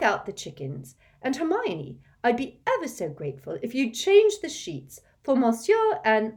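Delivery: high, loud motherly orders; bossy mother. Acted. Mrs Weasley assigns chores to Harry and Hermione, high bossy. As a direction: hand out chores briskly.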